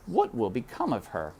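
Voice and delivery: plain reading voice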